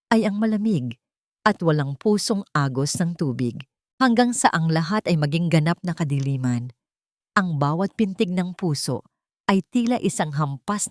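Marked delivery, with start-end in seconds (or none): none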